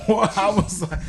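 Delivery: laughing through the words; can't stop laughing as you tell it; real laughter breaking into the line. Real, not acted.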